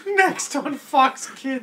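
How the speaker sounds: mock TV announcer.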